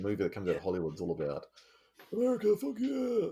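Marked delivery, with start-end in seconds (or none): 2.1-3.3 high loud mock Hollywood patriot; mock patriotic shout. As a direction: belt it like a gung-ho movie hero.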